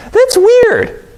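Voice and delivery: high puzzled aside. Real, not acted. Preacher marvelling at the townsfolk, high puzzled. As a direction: act out the strangeness.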